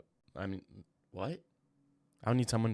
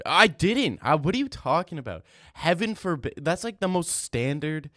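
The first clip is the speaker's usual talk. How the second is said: louder and higher, indignant denial; defensive outburst; 7 semitones higher, 8 dB louder than usual. Real, not acted. Streamer denies copying a chat user, indignant and loud. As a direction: protest loudly, offended.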